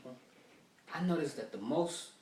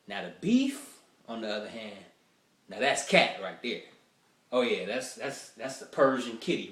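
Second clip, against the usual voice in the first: comic reveal about the beef, louder; loud punchline; 7 dB louder than usual. Real, not acted. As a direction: hit the punchline hard.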